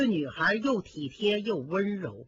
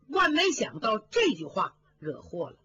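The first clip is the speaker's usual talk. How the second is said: ordinary delivery, nothing marked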